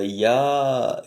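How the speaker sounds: ordinary delivery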